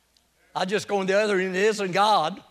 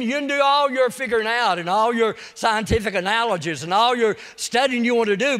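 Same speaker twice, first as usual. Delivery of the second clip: normal talking voice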